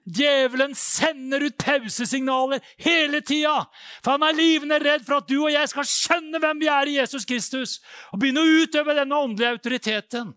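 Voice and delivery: high, loud preacher's warning; full-force preaching. Real, not acted. Preacher warns listeners about the devil's signal, shouting high. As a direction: shout it out to the congregation.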